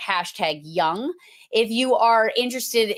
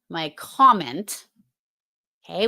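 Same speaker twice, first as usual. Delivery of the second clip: ordinary delivery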